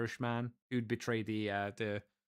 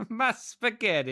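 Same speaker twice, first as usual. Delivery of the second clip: higher, slow, hammed-up mock Italian accent; 8 semitones higher, 4 dB louder than usual. Joining the Lamborghini joke with a hammed-up mock Italian accent. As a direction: play a cartoon Italian wailing over his pasta. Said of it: "doing a French accent".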